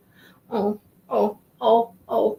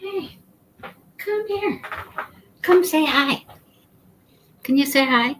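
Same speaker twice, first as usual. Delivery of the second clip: pet talk; 6 semitones higher than usual. Real, not acted. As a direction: coo at the kitty to come. Talking sweetly to the cat.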